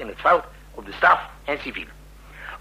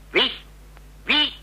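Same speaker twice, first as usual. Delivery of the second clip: sharp, much higher disbelieving wie?; can't believe who it is; incredulous wie; 10 semitones higher, 4 dB quieter than usual. Acted. Commander hearing who is at the door, incredulous. Surprised at visitor's identity.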